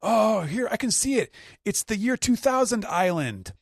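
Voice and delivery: high, loud excited cry; excited crew. Retold or imitated. Crew sighting the island, high excited voice. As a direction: spot the island with glee. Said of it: "they're like".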